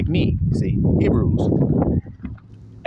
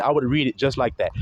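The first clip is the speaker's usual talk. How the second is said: ordinary talk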